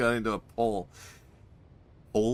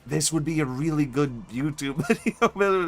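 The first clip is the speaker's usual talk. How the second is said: laughing; 5 semitones higher than usual. Real, not acted. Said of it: "milk this bit".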